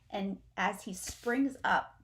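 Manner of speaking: ordinary delivery, nothing marked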